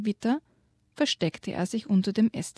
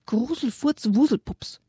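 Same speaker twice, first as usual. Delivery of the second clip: mock angry shout, pitched higher; angry yell; 4 semitones higher than usual. A hint of Yoshi bellowing his curse in anger. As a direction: burst out the curse in a mock rage. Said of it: "brüllte er".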